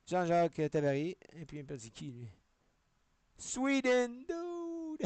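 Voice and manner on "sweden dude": excited shout. Real, not acted. Stunned by a finding.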